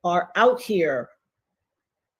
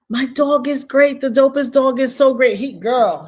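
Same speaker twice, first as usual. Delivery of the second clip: ordinary talk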